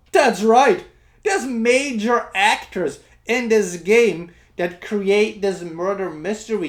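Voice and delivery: loud and high hype; excited. Real, not acted. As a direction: announce it with big excitement. Hyping the famous cast.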